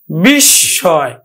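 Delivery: বিস্ময় slow as "bishshoy"; বিস্ময় said slowly, doubled s; slow pronunciation demo. Shown as a demonstration. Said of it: "দুইবার উচ্চারিত হবে".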